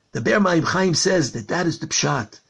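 ordinary delivery

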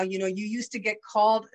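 ordinary delivery

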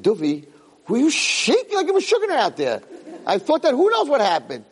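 teasing him high, through laughter; laughing question to the son-in-law; laughing tease. Retold or imitated. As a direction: rib him, laughing as you ask.

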